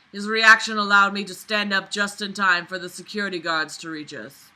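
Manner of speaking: usual speaking voice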